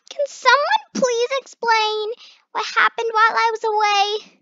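high, loud dismayed question; shocked demand. Acted. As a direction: ask like a stunned owner. Ruby shocked at the mess.

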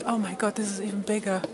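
normal talking voice

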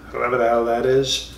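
ordinary talk, nothing marked

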